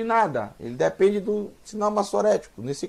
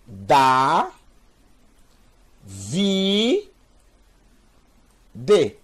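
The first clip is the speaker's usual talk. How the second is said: each syllable held long: DA, VI, then D apart; 3 dB louder, much slower than usual. Shown as a demonstration. Teacher spelling out a Hebrew name for beginners, syllable by syllable. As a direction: sound out Da, Vi, D with long gaps between. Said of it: "o som da letra final se junta".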